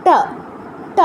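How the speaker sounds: ট with tongue curled back, sliding forward; retroflex ta. Shown as a demonstration. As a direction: curl the tongue to the roof for ট. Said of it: "জিহ্বাটা ভাঁজ করে মূর্ধায় স্পর্শ করে".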